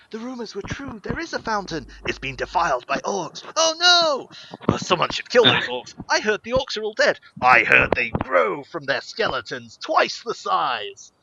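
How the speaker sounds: lively overlapping tavern voices; rumor-mongering patrons; tavern gossip. Acted. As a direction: chatter like townsfolk swapping rumors.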